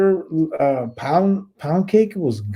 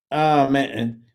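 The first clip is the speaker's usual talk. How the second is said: ordinary delivery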